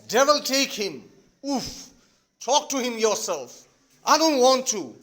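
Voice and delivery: loud, irritated stage delivery; exasperated outburst. Acted. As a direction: throw it out in acted exasperation. Father's acted outburst in the quarrel.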